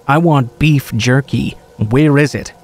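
ordinary delivery

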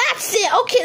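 ordinary talk

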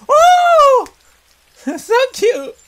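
high, loud delighted squeal. Real, not acted. Player spotting the baby chicks, squealing high with delight. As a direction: squeal at the sight of something adorable.

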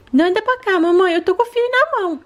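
put-on high childlike voice of a small daughter; little girl voice. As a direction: play the sick little girl talking to mom. Daughter doll speaking.

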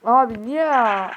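loud, slow, drawn-out whine of disbelief; exasperated complaint. Real, not acted. Gamer protesting a lost match, loud exasperated whine. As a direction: stretch it out like you can't believe it.

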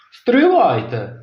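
high, loud wait; exclaim. A narrator's reading. Timi objecting to mum, high loud call. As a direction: call out to stop them. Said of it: "вигукнув Тімі".

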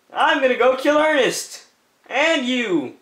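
usual speaking voice

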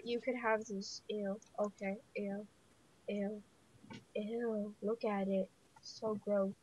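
soft, low grossed-out mutter; quiet disgust. Real, not acted. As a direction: murmur it, grossed out. Disgusted by a dropped item.

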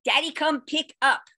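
put-on child voice telling the class; child announcing. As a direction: announce it like a proud toddler. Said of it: "he announced".

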